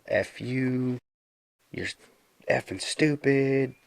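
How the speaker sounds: retelling a teen cursing at his coach, mock anger; retold angry outburst. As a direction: act out a player losing it at his coach, half-hearted. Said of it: "lost his crap".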